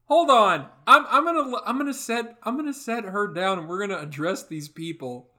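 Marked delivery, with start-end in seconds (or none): 0.1-3.6 loud strained character. Acted. Character straining, setting someone down.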